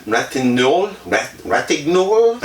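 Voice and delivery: "Ratignolle" tried out haltingly, as a question; unsure pronunciation. Real, not acted. Narrator unsure how to say the friend's name, hesitant "Ratignolle". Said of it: "I don't know how you'd say her name".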